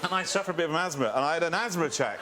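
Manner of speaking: ordinary delivery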